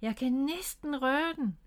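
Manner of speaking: high, drawn-out eager voice; excited straining. A narrator's reading. Miffy swinging toward the branch, excited. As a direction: stretch the words as you stretch to reach. Child almost reaching the branch.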